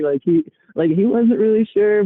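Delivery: ordinary talk, nothing marked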